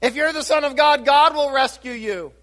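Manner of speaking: a preacher voicing the crowd's scornful jeers; mocking taunt. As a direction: sneer it up at him like a mocking crowd. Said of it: "many mocked and many said".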